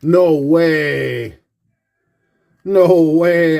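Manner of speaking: can't believe what's in his hands; stunned disbelief. Real, not acted. Collector recognizing a star's autograph, stunned and loud.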